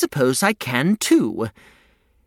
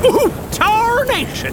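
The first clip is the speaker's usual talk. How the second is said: put-on old prospector; 14 semitones higher than usual.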